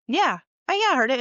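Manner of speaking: a bit too thrilled about the spooky noise; eager agreement. Retold or imitated. Coworker eagerly confirming she heard the whistle, excited.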